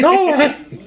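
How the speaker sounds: high shouted plea with laughter; hammed-up ashamed cry; mock wail. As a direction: beg not to be seen, half laughing. Cookie Monster caught eating dough.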